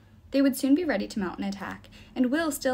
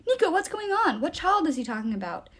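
higher at first, urgent confusion; alarmed questioning; 7 semitones higher than usual. Acted. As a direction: demand to know what's happening. Confused hero being dragged uphill.

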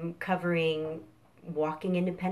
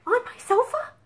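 very high surprised rise; surprised question; faster than usual. Acted. Chicken Sofa surprised by the nap idea, high. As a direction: ask it delighted and amazed.

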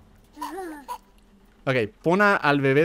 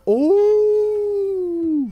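surprised oh; 4 dB louder than usual. Real, not acted. Sees teddy being burned.

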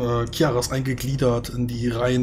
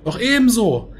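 booming voice over the battlefield; magically amplified voice; 11 semitones higher, 5 dB louder than usual. Acted. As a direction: proclaim it across the whole army. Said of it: "eine magisch verstärkte Stimme klingt".

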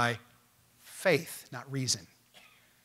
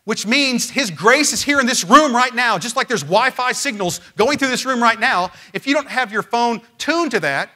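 louder, raised pulpit delivery, God's grace slowed and hammered; fervent preaching; 5 semitones higher, 6 dB louder than usual. Acted. Pastor explaining grace like Wi-Fi to congregation, fervent preaching. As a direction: drive the analogy home to the room.

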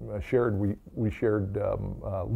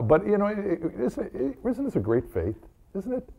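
enthusing; 11 semitones higher than usual. Real, not acted. Enthusing about the faith.

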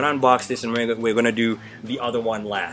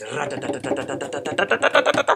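rolled tongue drum roll sound; mouth drum roll; 2 semitones higher than usual. Pretend. A drum roll for viewers before the reveal. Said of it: "drum roll, please".

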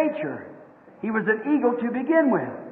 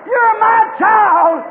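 high, loud mother eagle's cry; calling out; 6 semitones higher, 6 dB louder than usual. Acted. Mother eagle claiming her child among chickens, loud high call.

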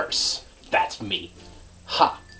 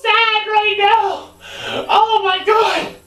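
whiny wail; 3 dB louder, slower than usual. Real, not acted. In ice bath.

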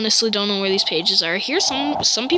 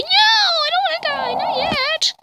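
high-pitched, hurried mock plea; shrill-pitched pretend begging for life; mock panic. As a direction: squeal it in comic panic. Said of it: "And I'll be like".

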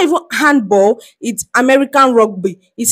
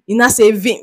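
usual speaking voice

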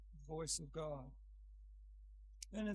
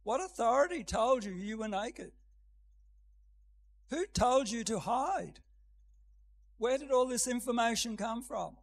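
probing question; 3 semitones higher, 7 dB louder than usual. Acted. Voices God questioning Adam.

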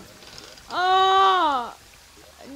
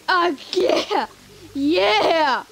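ordinary talk

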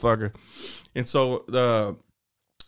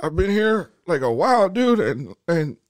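earnest inmate; 7 semitones higher than usual. Retold or imitated. Mexican inmate confessing.

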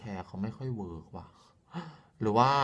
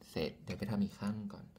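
whisper; 5 dB quieter than usual. Real, not acted. Aside to herself mid-makeup.

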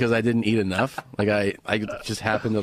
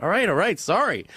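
higher-pitched, placating retold apology; hasty apology; 7 semitones higher than usual. Retelling giving in to the scolding, placating. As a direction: back down, mock-sheepish.

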